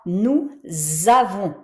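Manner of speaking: "nous avons" linked as nou-zavons; liaison Z in nous avons. Shown as a demonstration. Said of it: "je prononce comme un Z".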